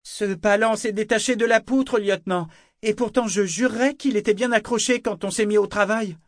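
put-on a boy calling up with feigned innocence; young voice. A narrator's reading. Young sailor reporting to the lieutenant, high and loud. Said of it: "Une voix jeune s'éleva".

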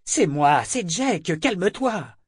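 high urging to calm down; soothing urgently. A narrator's reading. Jek holding struggling Althea, high and urgent. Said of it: "pour l'empêcher de se débattre".

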